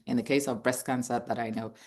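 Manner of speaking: ordinary talk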